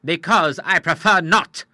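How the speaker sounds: short, slow and firm; a clipped, unbending no; curt refusal. A narrator's reading. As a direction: shut the matter down curtly. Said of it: "said the Admiral shortly".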